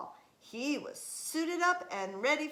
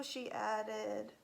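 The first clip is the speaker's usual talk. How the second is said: usual speaking voice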